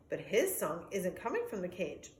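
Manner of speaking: plain reading voice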